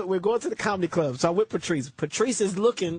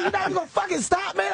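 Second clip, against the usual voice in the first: high, disbelieving protest; can't believe he won't stop; incredulous protest; 11 semitones higher than usual. Retold or imitated. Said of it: "I said".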